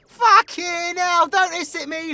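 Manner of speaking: high, loud yelp of alarm; startled protest. Acted. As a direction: jump back and protest, pitched right up. Startled by a hissing vampire.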